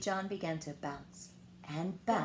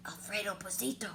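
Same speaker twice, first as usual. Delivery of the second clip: whispered; 6 dB quieter than usual. Acted. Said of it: "whispered the crowd".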